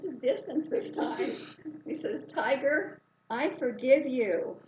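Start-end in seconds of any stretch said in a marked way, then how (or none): 2.3-4.6 louder, slower proclamation to the tiger; booming, deliberate pardon to the tiger; loud declaration. Retold or imitated. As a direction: call it out firmly from a distance. Declaring forgiveness from a distance.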